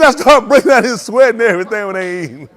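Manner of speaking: loud imitation through laughter; loud laughing impression of Haywood. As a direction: keep laughing through the whole retold line. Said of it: "He said".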